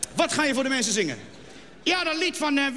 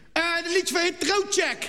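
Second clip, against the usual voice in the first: mangled English song title in plat Utrechts; thick Utrecht accent; 6 semitones higher than usual. Acted. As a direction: mumble the title in broad Utrecht. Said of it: "Ik heb het niet verstaan".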